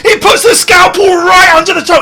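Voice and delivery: high-pitched squeamish alarm; squealing with horror at the sight; horrified commentary. Real, not acted. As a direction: narrate it in a rising panic. Horrified commentary on scalpel video.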